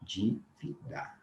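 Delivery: dívida drawn out softly, starting with dji; slow quiet dívida. Shown as a demonstration. Teacher demonstrating dívida for the transcription. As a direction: ease dívida out slowly. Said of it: "olha a representação fonética".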